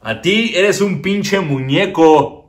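slow, mocking put-down; taunting. Comedian taunting his puppet, slow and mocking. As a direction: draw it out to mock the puppet.

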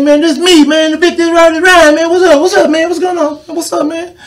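Rob Ryan on the phone. Retold or imitated. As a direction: hype him up like an old friend calling. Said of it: "He said".

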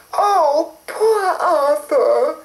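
high, slow, crying lament; tearful fairy. A narrator's reading. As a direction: sob it out like a sad fairy. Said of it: "said Mavis".

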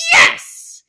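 loud, hard insistence; sharp loud yes. Pretend. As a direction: snap it back at full volume. Teacher insists he apologise.

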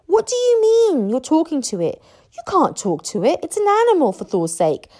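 incredulous protest with high, loud peaks; astonished. A narrator's reading. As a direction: object in sheer disbelief. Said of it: "gasped Fishlegs in astonishment".

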